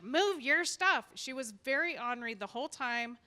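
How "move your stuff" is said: slow, louder mock snap; retelling the cranky vendor's demand, hammed-up; imitating a rude neighbor. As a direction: play the mean booth lady barking an order. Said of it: "She's like".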